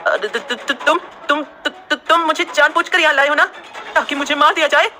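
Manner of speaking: stuttering, high and frightened; stammering in fear. A narrator's reading. Sneha stammering in fear to Kartik. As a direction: trip over every word in panic. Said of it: "हकलाते हुए".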